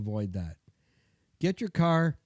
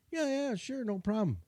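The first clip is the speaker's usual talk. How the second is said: much higher, breezy shop clerk; lightly voiced service clerk agreeing; easygoing clerk; 9 semitones higher, 5 dB quieter than usual. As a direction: toss it off like a relaxed counter guy. Shop clerk answers casually.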